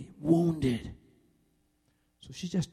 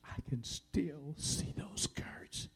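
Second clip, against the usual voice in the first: slow, near-whispered wounded words; hushed pain; 12 dB quieter, much slower than usual. Retold or imitated. Wife remembers childhood wound decades later, pained whisper. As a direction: imitate her hurt in a drawn-out whisper. Wife's old hurt, retold hushed.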